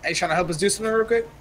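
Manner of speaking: ordinary talk, nothing marked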